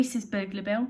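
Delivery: ordinary delivery, nothing marked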